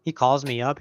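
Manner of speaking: ordinary talk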